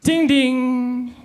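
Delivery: high sing-song ding ding; doorbell imitation. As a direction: chime it like a doorbell. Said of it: "Boniface Mwangi answering his doorbell".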